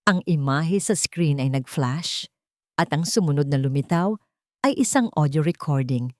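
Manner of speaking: usual speaking voice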